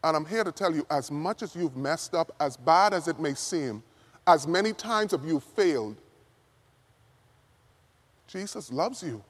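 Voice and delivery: soft, hushed, sincere preaching; gentle reassurance. Real, not acted. Preacher consoles someone who beats themselves up, gentle reassurance.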